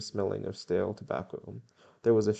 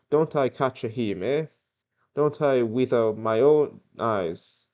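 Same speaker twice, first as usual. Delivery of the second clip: put-on rough Italian accent, raised and indignant; attempted Italian accent; 3 semitones higher, 7 dB louder than usual. Julio protesting to the squire, in a makeshift Italian accent. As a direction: have a clumsy go at an Italian accent. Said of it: "I can't do an Italian accent".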